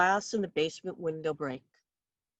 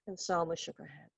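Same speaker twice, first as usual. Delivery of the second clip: usual speaking voice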